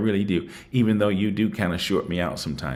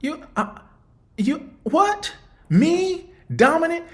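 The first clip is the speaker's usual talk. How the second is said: high-pitched disbelief, voice climbing higher at each question; incredulous outburst; 3 dB louder than usual. Retold or imitated. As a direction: retell the shock of being called dominant, pitch soaring. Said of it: "And I said".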